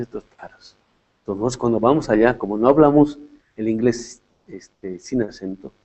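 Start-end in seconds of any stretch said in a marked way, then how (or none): 0.0-0.8 a mocking whisper under the breath; whispered scorn. Locals sneering at the accented speakers, whispered scorn. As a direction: hiss the insult softly, barely voiced. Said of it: "Entonces dice".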